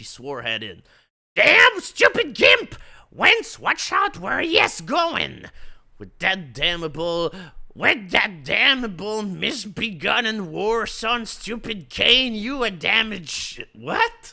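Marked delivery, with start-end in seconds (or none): 1.4-5.4 put-on furious yelling old lady; angry shout. Lady yelling at Bruce, furious shout. 6.0-14.3 angry rant. Acted. Lady's rant continues.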